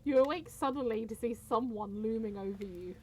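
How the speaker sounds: ordinary talk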